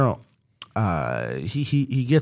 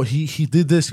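ordinary talk, nothing marked

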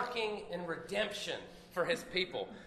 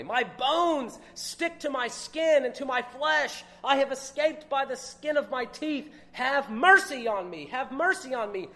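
forceful scripture reading; 3 semitones higher, 8 dB louder than usual. Acted. Preacher reading Job's protest to his friends, at full preaching force.

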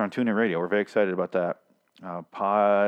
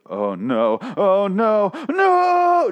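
super dramatic reaction; 12 semitones higher, 6 dB louder, much slower than usual. Acted. Rick hears how few were killed, super dramatic horror. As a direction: escalate into a hammed-up, drawn-out "no". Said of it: "super, super dramatic re-, uh, reaction to it".

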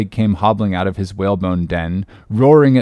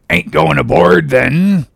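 higher, louder prying character voice; cracked stranger's voice; 7 semitones higher, 5 dB louder, slower than usual. Acted. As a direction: pester them as an odd old prophet. Elijah's prying question, put-on voice.

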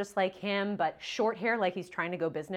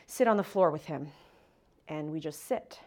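ordinary talk

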